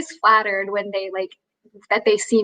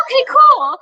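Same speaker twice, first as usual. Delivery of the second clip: much higher and louder nervous okay cool; voice went up; 13 semitones higher, 5 dB louder than usual. Retold or imitated. Starstruck waitress answering Ben Stiller, high nervous okay cool. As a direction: pipe it up high every time. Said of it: "And my voice went up".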